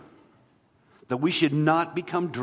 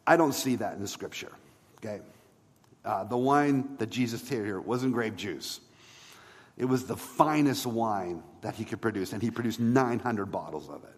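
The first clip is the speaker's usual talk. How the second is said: ordinary talk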